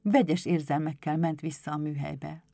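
ordinary delivery

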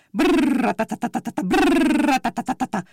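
rolled brrr then rapid tatata, like a motorbike; motorbike rattle. A narrator's reading. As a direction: imitate a roaring motorbike engine. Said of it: "rettenetesen berregett". (motorbicikli berregése)

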